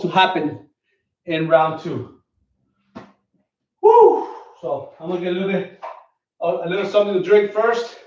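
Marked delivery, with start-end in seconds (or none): 3.8-4.3 sudden high whoop of relief after the workout; loud high woo, spent but happy; excited woo. Real, not acted. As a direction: let out a big high woo. Exhale after workout set.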